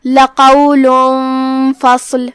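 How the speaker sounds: لقول فصل with the nunation hidden in a nasal hum before the f; chanted لقول, heavy q; ikhfa with ghunna. Shown as a demonstration. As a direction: nasalise the tanween of لقول, don't pronounce the n. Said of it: "تنوین کو چھپاتے ہوئے بغیر ظاہر کیے ہوئے لیکن غنہ کے ساتھ".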